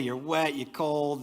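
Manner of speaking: usual speaking voice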